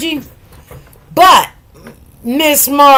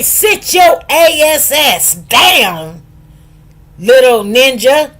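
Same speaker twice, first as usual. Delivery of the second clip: forceful parental order; 4 dB louder, slower than usual. Acted. Parent to kids who won't listen, forceful drawn-out order. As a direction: stretch out each word, laying down the law. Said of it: "But when you say".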